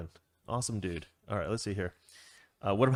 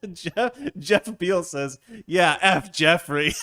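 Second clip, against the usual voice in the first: laughing while reading; 6 semitones higher, 5 dB louder, much slower than usual. Real, not acted. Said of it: "'Cause his name is Jeff".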